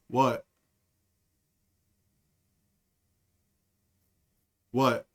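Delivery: low flat innocent what; deadpan. Retold or imitated. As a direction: play dumb with a straight face. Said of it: "with a straight fucking face".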